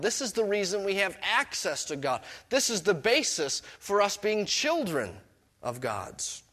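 ordinary delivery

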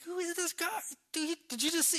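high, stammering bewilderment; spooked, tripping over the words; afraid. Acted. Disciples wondering who is this guy, afraid. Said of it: "They're afraid".

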